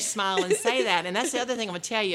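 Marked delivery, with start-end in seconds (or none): none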